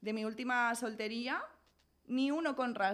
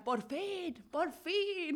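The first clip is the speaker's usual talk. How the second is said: alivio festivo, alargado y cada vez más agudo; celebración fingida; 7 semitones higher, much slower than usual. Retold or imitated. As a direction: alarga las palabras como celebrándolo al fin. El abuelo festeja en el cielo. (festejo teatral, lento y subiendo)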